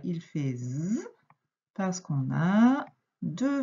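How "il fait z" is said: "il fait Z" with the S voiced as a buzzing z; "Z" said as a long voiced zzz; Z sound. Shown as a demonstration. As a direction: give "Z" a clear voiced buzz. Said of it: "le S, à chaque fois".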